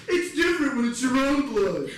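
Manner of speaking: high, loud mock protest; hammed-up wounded outcry; defensive yelling. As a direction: protest it loudly like an upset nephew. Nephew protests back from stretcher.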